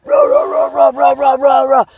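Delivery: high, loud rah with every punch; rhythmic battle yells; punching roar. Shown as a demonstration. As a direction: grunt out each blow on the bag. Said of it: "just let her rip".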